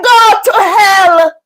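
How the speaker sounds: a slow, crying shout, full force. Retold or imitated. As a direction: hurl it back through tears. Retold shouting match, rebuking.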